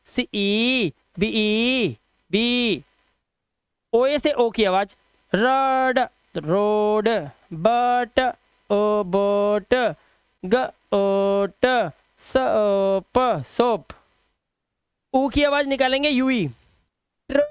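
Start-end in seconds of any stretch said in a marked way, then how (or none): 5.3-13.9 1 slow blending. Shown as a demonstration. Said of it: "teacher blending sounds into words".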